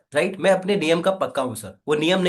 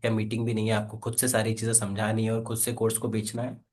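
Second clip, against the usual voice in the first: soft, lower, gentle persuasion; lovingly polite; 6 semitones lower, 4 dB quieter than usual. Shown as a demonstration. Sales trainer showing a trainee how to answer a customer, lovingly. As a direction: coax the customer kindly and quietly. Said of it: "बड़े ही हिंदी भाषा के अंदर प्यार से".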